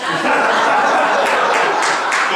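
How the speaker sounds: laughing at how his story ended; loud laugh. Real, not acted. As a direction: let the laugh burst out. Laughing at his own punchline.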